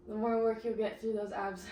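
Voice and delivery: ordinary delivery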